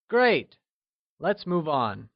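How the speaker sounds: usual speaking voice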